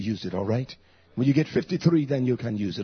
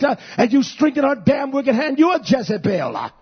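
raised, scolding pulpit voice; fired-up preaching; 9 semitones higher, 5 dB louder than usual. Real, not acted. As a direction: rebuke the congregation with full force. Preaches at full force.

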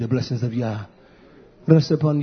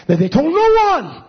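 emphatic preaching; 6 dB louder than usual. Real, not acted. Emphatic peak in sermon.

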